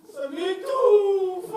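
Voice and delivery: loud, stretched laughing cry; choked outburst. Acted. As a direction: blurt it out, overcome. Fake emotional outburst.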